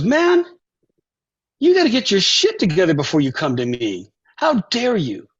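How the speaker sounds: retold indignant telling-off, jumping high at first; mock outrage of an offended idol; imitated scolding. Idol scolds the young player.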